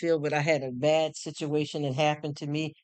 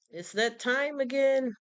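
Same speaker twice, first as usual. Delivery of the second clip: sing-song announcement; 9 semitones higher, slower than usual. Real, not acted. Announcing bedtime.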